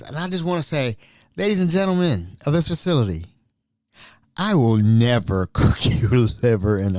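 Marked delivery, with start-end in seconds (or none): none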